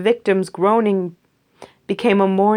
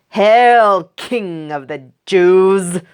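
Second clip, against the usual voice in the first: mocking hail; 2 semitones higher, 7 dB louder, much slower than usual. Soldiers mocking Jesus.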